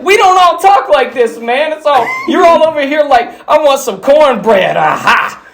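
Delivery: high, protesting impression; high mock exasperation; exasperated movie character. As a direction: pitch it way up in protest. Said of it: "He's like".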